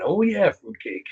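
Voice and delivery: a touch of grinning wrestler impression; Randy Savage-like [name]. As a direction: lightly imitate a wrestler's drawn-out exclamation. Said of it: "Almost like a Randy Savage".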